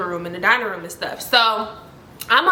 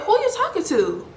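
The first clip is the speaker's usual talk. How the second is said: higher-pitched retold challenge; confronting question; 8 semitones higher than usual. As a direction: pointedly demand an answer, pitched up. Acted demand to brother on call.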